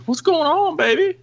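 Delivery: high, sweet woman's voice; wife coming to the door, high and sweet; imitating the wife. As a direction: put on the sleepy wife. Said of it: "she goes".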